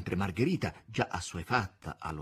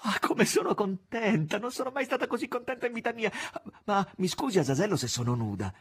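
high, loud, rushing with happiness; overjoyed exclamation; 12 semitones higher, 5 dB louder than usual. A narrator's reading. Margherita greets Azazello, bursting with happiness. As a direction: gush with joy at the visitor. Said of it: "Margherita esclamava".

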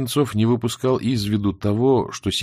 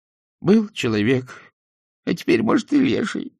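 high, weepy creature voice; tearful; 9 semitones higher than usual. A narrator's reading. Creature confesses to the interrogating soldier, tearful. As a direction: whimper it high and haltingly. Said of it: "заплакало существо".

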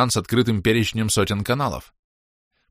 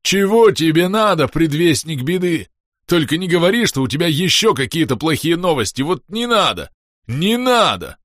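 eldritch horror; 8 semitones higher, 4 dB louder than usual. A narrator's reading. Klest keeps howling.

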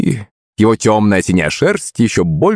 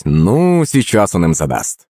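ordinary delivery

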